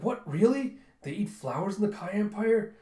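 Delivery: can't believe what you heard; incredulous. Retold or imitated. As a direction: general astonished at foreign tastes. Disbelief at flower-eating.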